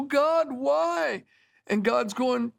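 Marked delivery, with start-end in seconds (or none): 0.0-1.2 exasperated cry. Retold or imitated. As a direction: fling the question up at heaven. Overworked man crying out to God.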